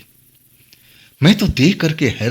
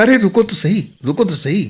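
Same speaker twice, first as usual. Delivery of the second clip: higher-pitched protest to halt someone; calling to stop; 7 semitones higher than usual. A narrator's reading. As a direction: call out for her to wait. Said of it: "तब मैंने लड़की को रोका". (ऊँचे स्वर में रोकते हुए)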